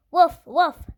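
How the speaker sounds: loud, high woof woof as a storybook dog; dog barking. Acted. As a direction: bark it out like a big dog arriving. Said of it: "said dog".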